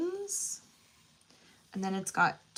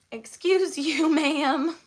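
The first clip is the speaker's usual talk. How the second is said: mock scolding; 6 semitones higher, 7 dB louder, slower than usual. Real, not acted. Scolding cat, laughing.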